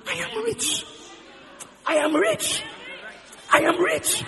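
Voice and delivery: high-pitched, emphatic repeated proclamation; excited declaration. Acted. Congregant's repeated declaration of wealth.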